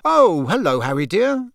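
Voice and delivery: a kind mother welcoming Harry; smiling mother. A narrator's reading. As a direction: greet him like a favourite son. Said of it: "spotting him and smiling".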